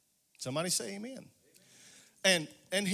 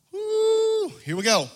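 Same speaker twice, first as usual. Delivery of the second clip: excited whoop; 14 semitones higher, 7 dB louder, slower than usual. Real, not acted. Preacher erupting in excitement.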